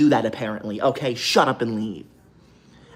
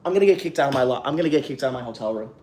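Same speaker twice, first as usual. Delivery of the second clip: normal talking voice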